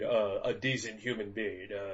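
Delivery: usual speaking voice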